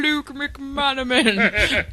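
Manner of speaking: slow answering machine announcer. Acted. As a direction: announce the name slowly. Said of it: "You have reached".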